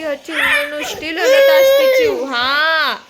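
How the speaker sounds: put-on high, loud sing-song cooing to a baby; baby talk. Mother talking to her crying baby girl in high baby talk. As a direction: coo and scold the crying baby gently, slowly.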